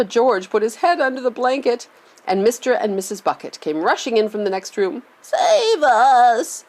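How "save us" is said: put-on higher, louder old woman's plea; old woman terrified by the crash; frightened cry. A narrator's reading. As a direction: cry out for rescue. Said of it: "cried Grandma Josephine".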